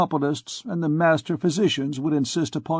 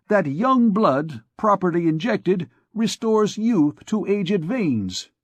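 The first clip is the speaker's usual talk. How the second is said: higher and heated, building indignation; heated argument; 7 semitones higher than usual. A narrator's reading. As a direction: argue it hotly, climbing in pitch. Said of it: "resumed Coictier hotly".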